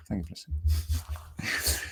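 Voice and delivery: slow, soft whisper; whispered sign-off after a quick first thank-you. Real, not acted. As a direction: breathe the thanks out gently. Sign-off repeated softly.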